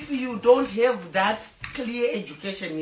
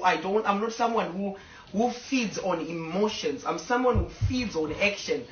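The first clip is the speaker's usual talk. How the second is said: ordinary delivery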